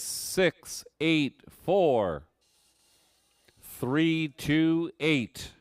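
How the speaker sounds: each digit slow and spaced; slow number readout. Acted. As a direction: spell out six eight four three two eight. Reads winning number slowly.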